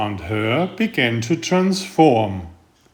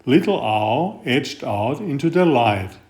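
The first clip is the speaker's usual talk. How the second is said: usual speaking voice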